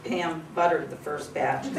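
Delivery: ordinary talk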